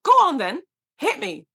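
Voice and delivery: high, loud dare; defiant challenge. Retold or imitated. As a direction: dare him to hit you. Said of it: "remember going to him and saying".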